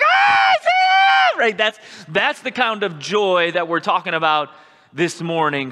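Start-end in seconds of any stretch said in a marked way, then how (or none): 0.0-1.4 high, loud, slow cry of joy; excited shout. Shown as a demonstration. Pastor to congregation, acting out a fan spotting someone famous, loud joyful shout. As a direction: shout it out like you just spotted a celebrity.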